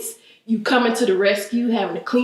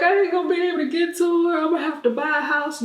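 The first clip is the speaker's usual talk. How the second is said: mock crying panic; 6 semitones higher, faster than usual.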